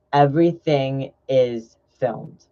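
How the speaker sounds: "Everything is filmed" slowly, word by word; slow, weighted "Everything ... is ... filmed"; slow emphatic repeat. Real, not acted. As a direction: draw out "Everything is filmed" as a warning.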